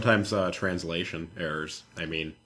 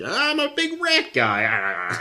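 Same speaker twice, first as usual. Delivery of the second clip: slow, higher, hammed-up mock voice; mock character voice; 7 semitones higher, 4 dB louder, much slower than usual. Mocking a flamboyant game character, slow high put-on voice. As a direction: drag out a silly put-on voice.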